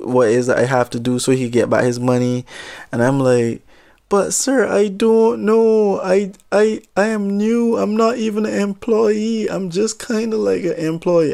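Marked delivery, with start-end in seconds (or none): 4.1-11.3 high, slowed, pleading voice, much higher; panicked pleading. Retold or imitated. Intern pleading with the angry customer, high and panicky. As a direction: beg him, flustered and scared.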